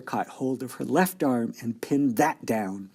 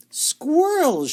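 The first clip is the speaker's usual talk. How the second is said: put-on high shrill-free squeal of a spoilt girl; shout; 7 dB louder than usual. A narrator's reading. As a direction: shriek it out like a spoilt child. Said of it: "shouted Veruca Salt".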